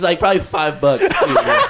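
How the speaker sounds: cracking up at himself; laughing. Real, not acted. Telling his friends the theft was only five bucks, laughing hard.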